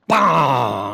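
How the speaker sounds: sudden loud barking roar; biker's roar. Retold or imitated. Biker pounding the Volkswagen roof, roaring loudly. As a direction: bang out a big loud roar.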